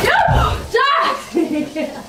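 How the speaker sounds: high, loud shout of his name; calling out. Real, not acted. Friend calls out after fall.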